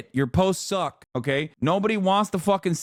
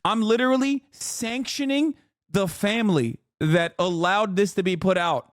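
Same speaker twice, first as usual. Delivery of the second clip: usual speaking voice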